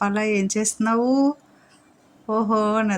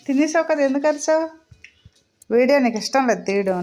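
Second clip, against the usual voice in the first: playful scolding; 6 semitones higher than usual. Real, not acted. Owner teasing barking dog, high playful scold. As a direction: tease the dog for yelling.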